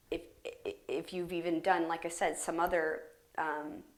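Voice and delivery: normal talking voice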